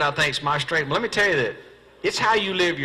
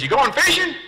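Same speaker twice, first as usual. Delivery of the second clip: mock clerk voice, much higher and louder; gas station guy; 11 semitones higher, 3 dB louder than usual. Imitating the gas station clerk asking a dumb question, much higher. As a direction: play the clueless attendant asking the obvious. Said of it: "He goes".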